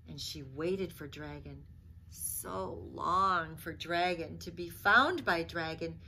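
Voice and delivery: put-on expressive read-aloud for children; lively picture-book reading voice; storybook narration. A narrator's reading. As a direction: tell it like a bedtime story.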